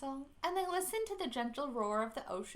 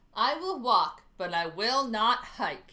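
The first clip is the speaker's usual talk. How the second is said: grumbling; 8 dB louder than usual. A narrator's reading. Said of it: "he grumbled".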